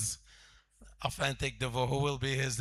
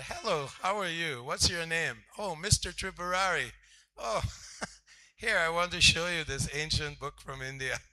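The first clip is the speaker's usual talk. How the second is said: mock salesman pitch; 4 semitones higher than usual. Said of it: "in the same way".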